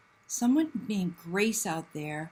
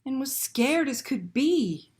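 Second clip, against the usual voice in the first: put-on lively read-aloud to children; animated children's story narration; storytime reading; 3 semitones higher, 4 dB louder than usual. A narrator's reading. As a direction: read it like a picture book to kids. Reads picture book aloud.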